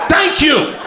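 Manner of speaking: high, dainty thank you; high, sugary thanks; sweet thank you. Retold or imitated. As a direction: gush it out sweetly. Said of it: "I was like".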